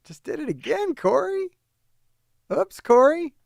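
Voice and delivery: high singsong tease, Oops Corey; playful jeer at the rival; mocking taunt. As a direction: needle him in a high mocking lilt.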